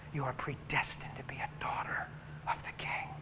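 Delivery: soft tender whisper. Retold or imitated. Preacher tells the girl her worth, whispered. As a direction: hush it like a secret blessing.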